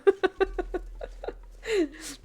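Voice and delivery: long, high giggling fit; real laughter. Real, not acted. Nervous joke about reading German.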